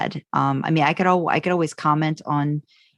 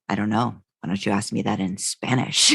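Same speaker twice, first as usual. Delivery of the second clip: a much lower mock Dean voice, lightly played; Dean impression; 8 semitones lower than usual. Co-host imagining Dean asking for it in Spanish, mock low voice. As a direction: grumble it like Dean, pitched way down. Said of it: "is Dean going".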